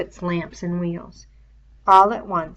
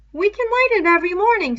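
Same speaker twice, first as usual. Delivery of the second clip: put-on higher, louder boy's voice; Piggy's voice; 13 semitones higher, 9 dB louder, faster than usual. A narrator's reading. Piggy arguing about the fire, higher boy voice. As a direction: voice a fussy schoolboy.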